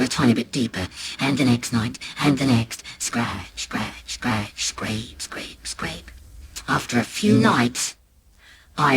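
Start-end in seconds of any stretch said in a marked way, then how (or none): 3.0-6.1 low, soft rhythmic scrape; secretive, half-whispered chant; hushed scratching sounds. A narrator's reading. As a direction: imitate furtive scratching under the breath. Imitates secret scraping at the wall.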